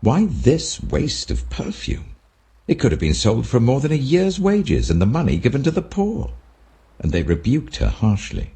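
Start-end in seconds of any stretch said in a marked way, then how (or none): 0.0-6.3 raised voice, offended at the waste; indignant. A narrator's reading. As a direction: scold the waste in disbelief. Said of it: "saying indignantly to one another".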